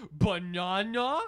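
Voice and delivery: slow, loud, high puzzled "Banana?"; mock puzzled. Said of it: "I was like".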